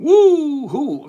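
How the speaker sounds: long, high, loud woo-hoo; excited whoop. Real, not acted. Host greeting William in the chat, excited whoop. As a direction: let out a drawn-out cheer.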